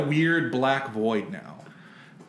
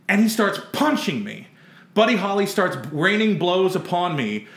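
normal talking voice

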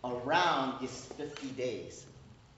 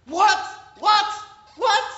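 much higher and louder, frantic; alarmed shouting; 13 dB louder, slower than usual. Retold or imitated. Air traffic controller can't understand the pilot, frantic shout. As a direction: yell back over the radio in panic.

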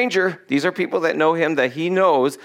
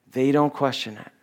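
usual speaking voice

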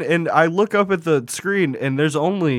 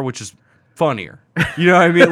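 usual speaking voice

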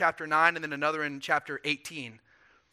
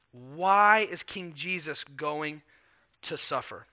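slow, weighty question; slower than usual. Shown as a demonstration. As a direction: draw out each word. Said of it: "emphatic restated question".

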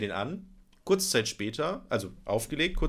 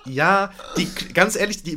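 laughing; 5 semitones higher, 6 dB louder than usual. Real, not acted. Laughing while quoting self.

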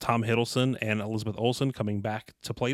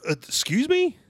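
much higher, louder shocked question; 14 semitones higher, 5 dB louder than usual. Real, not acted. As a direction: gasp it in disbelief. Shocked reaction.

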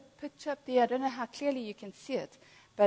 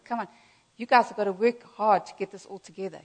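ordinary talk, nothing marked

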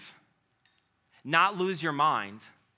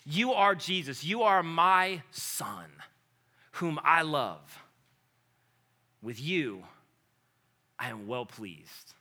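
voice from heaven; slower than usual. Retold or imitated. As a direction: speak each word slowly as a loving father. Voice from heaven quoted.

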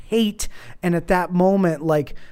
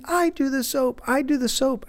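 lightly voiced little kid, higher; a small boy insisting, pitched up; quoting his son; 8 semitones higher than usual. Father imitating his son insisting on the soap, a kid voice.

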